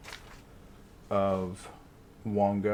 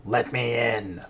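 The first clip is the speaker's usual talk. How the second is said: monkey spirit scream; 3 semitones higher, 4 dB louder than usual. Acted. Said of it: "roars at Nithla and screams".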